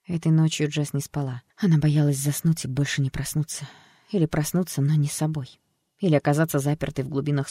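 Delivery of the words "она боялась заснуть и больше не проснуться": ordinary delivery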